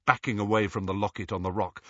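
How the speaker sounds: plain reading voice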